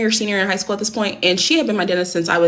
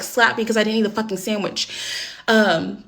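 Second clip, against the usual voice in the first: ordinary delivery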